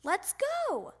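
high, drawn-out summons; father hollering from another room; calling out. A narrator's reading. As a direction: call it out high to hurry him along. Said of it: "called his father".

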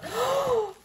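high surprised intake of breath; quick excited gasp; sharp gasp. Real, not acted. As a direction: gasp in delight. Gasps at the next item.

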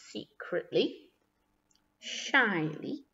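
hushed, slow, furtive narration; secretive and shy. A narrator's reading. Narrator telling children how he looked at pigeons, secretively. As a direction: drop softly, as if sneaking a look. Sneaky looks acted in narration.

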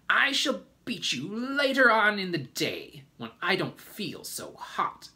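high, loud threat that eases off; angry threat. A narrator's reading. Aunt Spiker threatening James with a beating, angrily. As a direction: start at a shriek, then come down.

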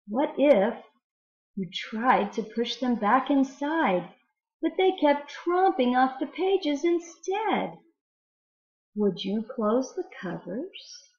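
lively storybook read-aloud with wonder; reading to children. Acted. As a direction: read it to the class like a fun picture book. Teacher reads a poem aloud.

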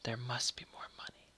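hushed, haunting whisper; secret whisper. A narrator's reading. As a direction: whisper it as if the house were speaking. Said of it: "he heard the secret whisper".

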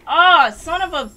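loud high angry outburst; furious shout. Real, not acted. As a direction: rage at the game. Raging at game death.

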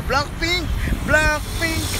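high cheering shout of the name; excited shout. Real, not acted. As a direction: cheer it out like a fan. Cheering at concert venue.

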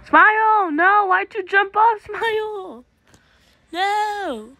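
very high wail, loud then fading; distressed cry. Real, not acted. Player to teammate who jumped off, high dismayed wailing. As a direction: wail helplessly after a friend. Friend jumps off again.

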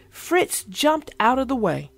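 ordinary delivery

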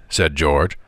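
usual speaking voice

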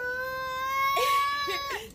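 squealing nervous giggle; shrieking laugh of nerves; high nervous laugh. Real, not acted. Nervous before ice bucket dump.

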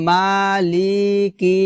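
মালিকি with every syllable dragged out; wrong lengthening. Shown as a demonstration. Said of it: "এভাবে হবে না".

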